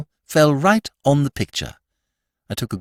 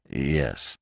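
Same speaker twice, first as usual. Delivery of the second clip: doubtful; 9 semitones lower, 6 dB quieter than usual. Acted. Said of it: "His voice sounded rather doubtful".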